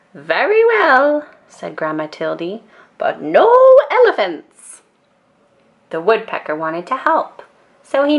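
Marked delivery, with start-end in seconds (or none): 0.1-1.3 put-on higher, louder, slow old lady; drawn out, higher and louder; Grandma Tildy. A narrator's reading. Grandma agrees. 3.0-4.8 "elephants" much higher and louder; firm no elephants. A narrator's reading. Grandma's refrain.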